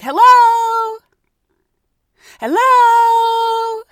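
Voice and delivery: high, loud hello into emptiness; calling out. Retold or imitated. Tourist calling at the empty gate shack, loud hopeful call. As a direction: call out to whoever might be inside.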